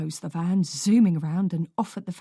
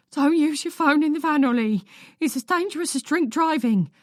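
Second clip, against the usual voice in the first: worried mother; 7 semitones higher, 4 dB louder than usual. Acted. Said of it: "worried, wobbly voice".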